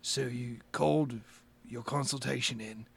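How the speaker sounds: lightly voiced, soft, wary boss; a hint of a guarded businessman, kept low; Mister Holder. Businessman questioning a caller on the phone, quietly wary.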